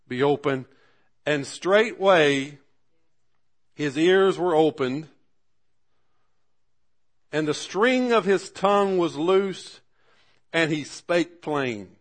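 loud, high, drawn-out scripture proclamation; ringing sermon climax, each phrase stretched out; full-force preaching. Real, not acted. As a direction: proclaim the miracle slowly, voice lifted.

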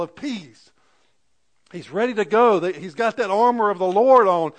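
loud, high, rousing sermon; rally them like fighters, at full force; fervent preaching. Real, not acted.

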